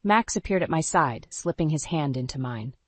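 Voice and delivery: plain reading voice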